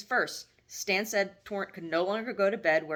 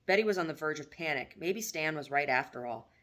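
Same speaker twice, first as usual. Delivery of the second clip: plain reading voice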